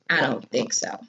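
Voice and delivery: low, soft, cold refusal; steely stare. Shown as a demonstration. Boy refusing his sister's offer, low and icy. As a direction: say it flat and cold, staring him down. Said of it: "I imagine it probably looked like this".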